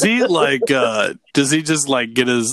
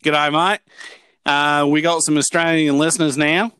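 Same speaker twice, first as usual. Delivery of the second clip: put-on Aussie greeting, breezy; playful amateur Australian accent; 4 dB louder than usual. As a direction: address the listeners in a mock Australian accent. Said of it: "That's a pretty good accent".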